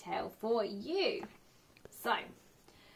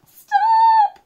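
high, loud panicked scream; 17 dB louder than usual. A narrator's reading. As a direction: shriek it in panic. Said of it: "cried the gingerbread man".

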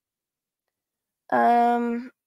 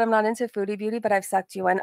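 ordinary delivery

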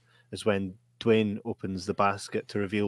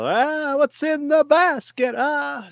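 high-pitched mock horror-film cry; hammed-up trailer quote; 4 dB louder, slower than usual. Podcaster mimicking the hokey film trailer, hammed-up high voice. Quoting the film's trailer, mockingly.

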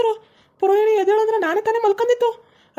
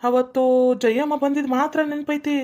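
much lower, groaning dismay; 6 semitones lower than usual. Acted. Trapped priest groaning to himself, low and dismayed. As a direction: sink into a low, worried moan.

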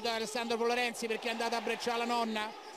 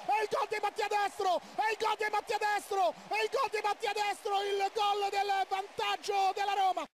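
excited goal call, high and rising; breathless italian goal commentary; 10 semitones higher, slower than usual. Real, not acted. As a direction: scream the goal call live. Live goal call by radio commentator.

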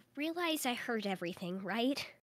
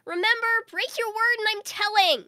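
calling out; 5 dB louder than usual. Acted. Sister calling after brother.

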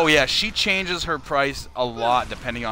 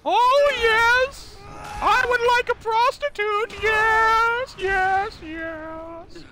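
a much higher, drawn-out lecherous boss voice; Vince McMahon impression; much slower than usual. Streamer reading a donation as the wrestling boss, in a much higher put-on voice. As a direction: ham up a leering wrestling boss. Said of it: "the next donation will get a Vince McMahon".

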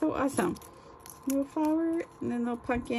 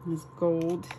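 normal talking voice

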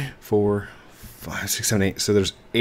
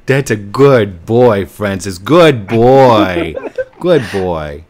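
praising a pet; 12 dB louder, much slower than usual. Real, not acted. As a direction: coo at him like a dog.

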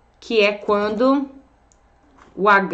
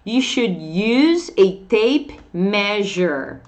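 very slow, careful English; deliberately slow teacher reading; slow model sentence; much slower than usual. Shown as a demonstration. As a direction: read it slowly for the class. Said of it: "Ó o jeitinho de falar essa palavrinha".

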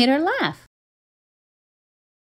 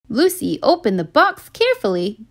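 ordinary delivery

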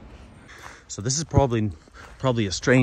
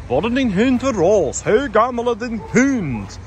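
much higher, slow sweet Swedish questions; locals gushing over dog; 12 semitones higher, 3 dB louder than usual. Retold or imitated. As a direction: gush over a stranger's dog. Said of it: "And they're like".

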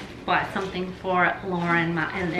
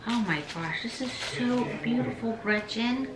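hushed awe; 4 semitones higher, 7 dB quieter than usual. Real, not acted. Admiring gift.

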